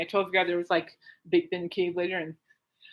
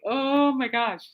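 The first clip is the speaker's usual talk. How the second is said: exasperated; 5 semitones higher, much slower than usual. Retold or imitated. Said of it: "She's like".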